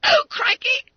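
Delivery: very high, loud squeals; flabbergasted. Acted. Fat schoolboy staring at unexpected money, flabbergasted. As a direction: squeak each exclamation in stunned disbelief. Bunter stunned by two postal orders.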